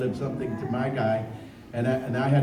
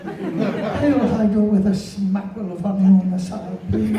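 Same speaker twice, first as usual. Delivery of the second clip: slow, higher, laughing character voice; cartoon bear voice; 7 semitones higher, 5 dB louder, slower than usual. Voice actor treating fans to a cartoon bear line. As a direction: savor the honey like a sweet cartoon bear.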